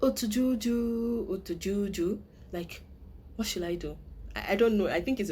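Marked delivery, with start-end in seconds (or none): 0.0-2.2 a hint of a drama character pleading; half-sung, slowed worried drama quote; drama line. Fan quoting a favorite drama line, lightly acted. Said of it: "where he usually tells the doctor".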